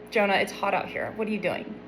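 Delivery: put-on quietly checking in on a sulking kid; gentle God voice. God checking on sulking Jonah, soft and concerned.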